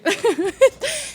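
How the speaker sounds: high giggle. Real, not acted. Comic amused at her own refusal, giggle. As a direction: giggle to yourself. Laughing after refusing to show recording.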